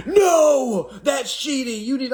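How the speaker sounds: high, loud mock crying girlfriend; hammed-up wailing protest; mock whiny woman. Mocking women calling it cheating, high wail. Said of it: "Women be like".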